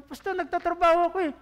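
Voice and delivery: high, fast whiny excuse; mock congregant protest. Pastor mimics a congregant protesting that they work, high and fast. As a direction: toss off the excuse like a defensive churchgoer.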